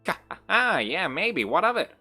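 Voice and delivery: high, loud, brazen taunt after a cackle; cackle, then throw it back defiantly; cackling taunt. Acted. Villain answers whether it is a shade, cackling taunt. Villain laughs off her question.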